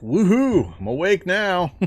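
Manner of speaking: high, loud woohoo; excited cheer. Real, not acted. Cheering in game.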